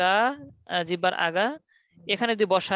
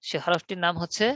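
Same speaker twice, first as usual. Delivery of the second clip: ordinary talk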